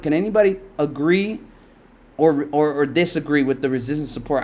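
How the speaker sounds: ordinary delivery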